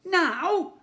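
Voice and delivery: high, loud startled now; asking. A narrator's reading. Ned asking Slime in alarm, high and loud. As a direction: ask it in alarm. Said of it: "asked the boy".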